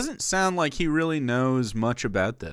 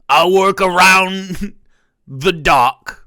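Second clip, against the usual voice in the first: loud, slow British dockworker caricature; stretched-out fake British with a laugh; mock British accent; 8 semitones higher, 13 dB louder, much slower than usual. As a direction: holler it as a hammed-up British docker. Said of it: "do they call it the docks".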